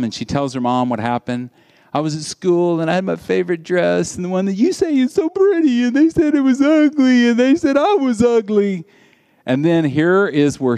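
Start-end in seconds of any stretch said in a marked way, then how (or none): 1.9-8.9 put-on upset little girl. Daughter telling her mom about school, rising hurt. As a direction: pour it out like a wounded little girl.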